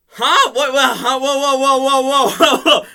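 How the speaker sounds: baffled. Retold or imitated. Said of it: "Blue's just like".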